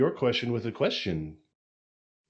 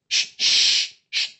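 shushing; 5 dB louder, much slower than usual. Acted. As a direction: hush him gently. Shushing the listener.

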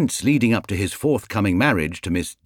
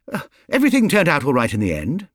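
quick, higher, cutting in fast; hasty; 6 semitones higher, 3 dB louder, faster than usual. Acted. Mrs Brown hastily cutting in to calm the family, quick and higher. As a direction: hurry to smooth things over. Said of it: "broke in hastily".

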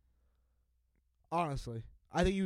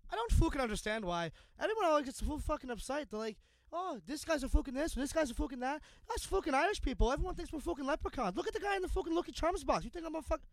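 higher, fast Irish rant, lightly done; Irish customer impression; 8 semitones higher than usual. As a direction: rattle it off like a worked-up Irishman, mild Irish lilt. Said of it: "He's from Ireland".

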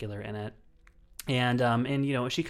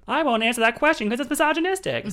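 much higher, louder, hammed-up American delivery; own voice exaggerated; 13 semitones higher, 8 dB louder than usual. Shown as a demonstration. Host showing the quote fails in their own voice, exaggerated high delivery. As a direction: blurt it high and loud without the accent. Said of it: "with my voice being like".

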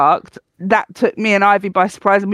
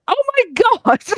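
much higher startled exclamation; shocked squeal. Retold or imitated. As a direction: react to the sudden bark. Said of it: "and I was like".

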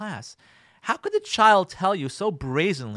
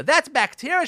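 higher, louder mimicking with a rise on "That's bacteria"; mocking echo; 10 semitones higher, 7 dB louder than usual. Wife mocking husband's excuse, scornful. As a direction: throw "That's bacteria" back at him mockingly. Wife mocks his word.

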